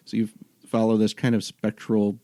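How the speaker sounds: ordinary talk, nothing marked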